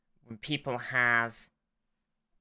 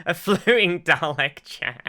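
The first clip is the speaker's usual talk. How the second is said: laughing; 6 semitones higher, 8 dB louder than usual. Real, not acted. Joking about a Dalek chair.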